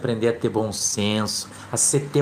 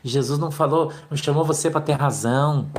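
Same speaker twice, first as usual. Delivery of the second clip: normal talking voice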